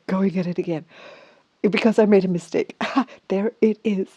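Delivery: ordinary talk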